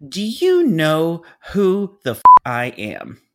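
slow, loud, high outburst, dropping at the end; imitated screaming. Planner pulling rank with a curse, slow scream. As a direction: stretch each word into an outraged yell. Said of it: "She continued to scream at me".

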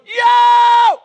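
loud high yell; desperate shout. Retold or imitated. Blind beggar shouting for Jesus, loud high yell. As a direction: shout it out with everything you have. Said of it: "all I could shout was".

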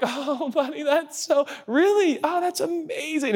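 mock-impressed dad; fake enthusiasm. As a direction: pretend to be amazed by your kid. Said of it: "and you're like".

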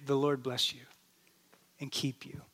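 soft, hesitant prayer; quietly, unsure of yourself; nervous blessing whispered after a shouting stranger. Retold or imitated. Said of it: "I felt nervous".

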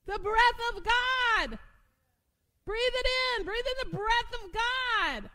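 loud, high, slow preaching cry; soaring pulpit proclamation; full-force preaching. Acted. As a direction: lift the room with each phrase.

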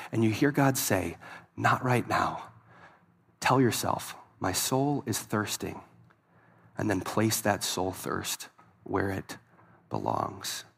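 low, soft, slow voice; intimate sermon. Real, not acted. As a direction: lean in and say it gently. Preacher quiet, intimate application.

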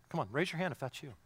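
ordinary talk, nothing marked

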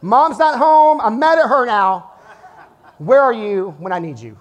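hammed-up panicked dad; laughing mock panic, calling for mom. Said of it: "I am freaking out".

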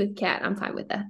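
breathy whispered joke; whispered aside. Real, not acted. Teasing aside to co-host, whispered. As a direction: drop to a whisper for the punchline.

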